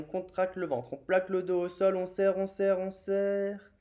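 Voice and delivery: pressing coach voice, held up high; urging effort. Real, not acted. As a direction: drive the students through the squeeze. Urging students through a muscle squeeze.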